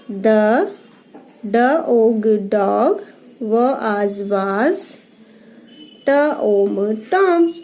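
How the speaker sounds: slow teacher reading by letter sounds; phonics reading. Shown as a demonstration. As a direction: sound out each word, then blend it. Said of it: "ये paragraph read करेंगे". (ध्वनि जोड़-जोड़कर पढ़ना)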